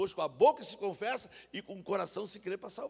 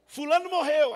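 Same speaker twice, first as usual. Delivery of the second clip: loud announcement; 9 semitones higher, 14 dB louder, slower than usual. Retold or imitated.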